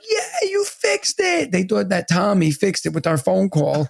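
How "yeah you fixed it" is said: put-on high, slow child voice; dad imitating his children's delight; kids' voice. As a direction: mimic the kids cheering. Kids cheering dad in story.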